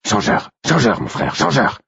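rasping, urgent wolf call; wolf companion. A narrator's reading. Wolf calling his silent brother, urgent rasp. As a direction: call your brother in a rough whisper-edged voice. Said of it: "Mon propre loup qui m'appelait ainsi".

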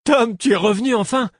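thrilled to see his friend again; joyful cry. A narrator's reading. Drunk friend greets returning Tom.

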